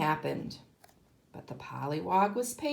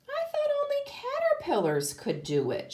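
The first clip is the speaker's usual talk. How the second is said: much higher, wistful caterpillar voice; sadly. A narrator's reading. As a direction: let the caterpillar sound let down. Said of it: "she said rather sadly".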